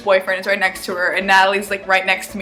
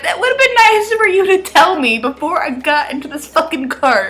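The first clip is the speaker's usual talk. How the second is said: sarcastic retort; 8 semitones higher, 3 dB louder than usual. Retold or imitated. Annoyed retort to Natalie.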